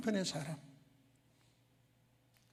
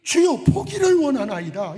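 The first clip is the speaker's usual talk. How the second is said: high, loud, slow crying plea with stress on 보기를; tearful shouted prayer; fervent cry; 7 semitones higher, 11 dB louder than usual. Shown as a demonstration. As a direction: beg with full passion, as the right answer. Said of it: "이게 정답 아닙니까?".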